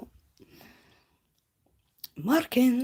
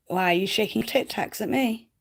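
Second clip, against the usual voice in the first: mock indignant; 5 semitones higher, 8 dB louder than usual. Real, not acted.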